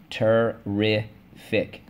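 "terrific" stretched slowly as ter-rif-ic; syllable by syllable. Shown as a demonstration. Teacher leading the class, slowly syllable by syllable. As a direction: tap "terrific" out slowly, one syllable at a time. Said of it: "let's go".

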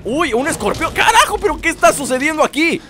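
high-pitched startled outburst; startled alarm. Real, not acted. Gamer stumbles on a scorpion, high startled alarm. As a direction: jump into a high squawk of alarm. Scorpion suddenly appears in game.

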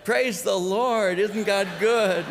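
high, slow put-on cheerfulness; forced cheer. Upset pastor greeting his church member, hammed-up cheer. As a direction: paste on a pious smile over the anger.

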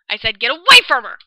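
angry shout. Acted. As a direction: shout her off the bed. Repeats warning, shouting.

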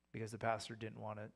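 ordinary delivery

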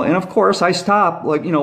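ordinary delivery

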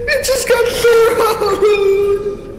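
yelling while laughing hard; slow, shouted through laughter. Real, not acted. Laughing after camera thrown.

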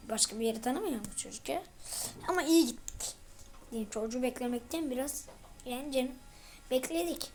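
usual speaking voice

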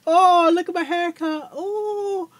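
loud high squeal over a haircut; squeaky pony dismay. Acted. Pony shrieks at her own haircut, high and loud. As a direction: shriek about your hair like a vain filly.

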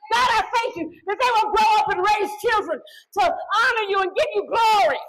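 loud, high, building preacher's cry; preaching at full force. Acted. Preacher rallying parents to speak life over children, shouting. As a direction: lift the congregation with rising fire.

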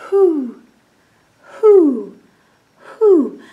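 high, drawn-out hoo of an owl; owl hooting. Acted. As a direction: hoot like an owl in the dark.

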